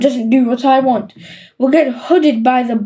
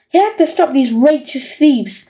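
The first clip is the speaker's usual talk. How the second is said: usual speaking voice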